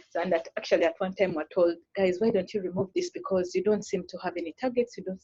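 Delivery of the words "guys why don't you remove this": usual speaking voice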